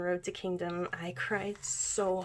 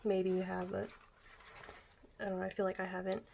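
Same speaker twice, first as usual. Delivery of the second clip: ordinary delivery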